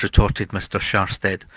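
plain reading voice

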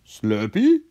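high, rising call; questioning character voice. A narrator's reading. Socko checks whether Slurpee listens, high questioning voice.

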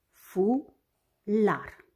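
"Fular" as two separated syllables, fu - lar; despărțit în silabe. Shown as a demonstration. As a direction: say "Fular" syllable by syllable with a clear gap. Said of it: "are două silabe". („Fular” rostit rar, pe silabe: fu - lar)

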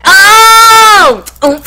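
surprised. Retold or imitated. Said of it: "I was like".

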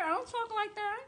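high, put-on sugary voice; high-pitched mock sweetness; mock sweet-talker. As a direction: mimic the sweet talk while disowning it.

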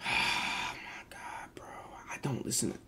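breathy whispered sigh of defeat; exasperated whisper. Real, not acted. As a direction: give up and whisper it. Exasperated denial to chat.

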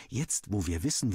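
ordinary delivery, nothing marked

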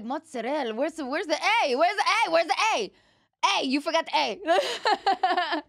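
high, loud comic protest at a dropped vowel; loud, high-pitched teasing complaint; mock indignation. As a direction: demand it with escalating mock outrage. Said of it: "because I'm like".

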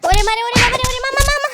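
high, hammed-up yelps of pain; wailing in pain. Delivery man beaten by customer, wailing. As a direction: cry out under the blows, pitch climbing.

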